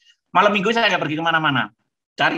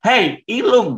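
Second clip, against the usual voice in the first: high, loud call of the name; 7 semitones higher, 6 dB louder, much slower than usual. Retold or imitated. Foreign girlfriend calling him on the phone, loud and high.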